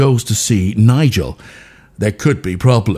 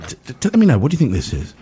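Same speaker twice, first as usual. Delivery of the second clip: normal talking voice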